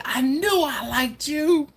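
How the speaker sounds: high, drawn-out excited squeal; acted high-pitched delight; delighted exclamation. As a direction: read it out as a gleeful, stretched cry. Voicing Angela's delighted chat message.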